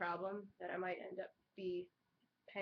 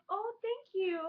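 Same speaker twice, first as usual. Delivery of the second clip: surprised; 11 semitones higher, 4 dB louder than usual. Retold or imitated. Quoting herself, surprised.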